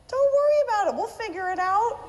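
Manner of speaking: high, airy, carefree dismissal, mocking; breezy brush-off. Mocking the person who tells a planner not to worry, airy high brush-off. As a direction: toss it off like it's nothing. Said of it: "The worst thing you can say".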